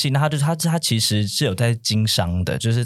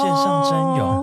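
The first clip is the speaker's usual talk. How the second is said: high, slow, sung-out echo; mock-wowed sing-song; slower than usual. Real, not acted. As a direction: float the words up high in delight. Echoing the event name.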